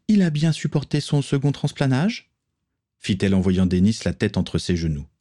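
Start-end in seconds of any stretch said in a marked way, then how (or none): 0.1-2.3 put-on higher, scornful young woman's voice; young pirate woman. Morgan scolds the count, scornful female voice. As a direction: talk down to the cowardly count.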